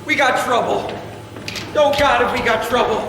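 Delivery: alarmed warning. Acted. Warning the group the police have arrived, alarmed and loud. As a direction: burst in with bad news.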